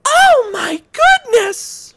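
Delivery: high, gentle princess voice. Acted. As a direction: speak as a sweet princess. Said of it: "Should I do Princess?".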